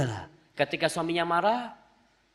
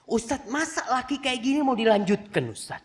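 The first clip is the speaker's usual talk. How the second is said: complaining mother; 6 semitones higher than usual. Retold or imitated. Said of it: "Kata dia".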